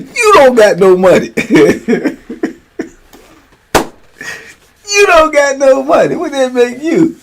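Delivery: high and loud, teasing with a laugh breaking in; loud mocking kid. Grandson mocking granddad for having no money. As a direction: crow it at him like a cheeky toddler.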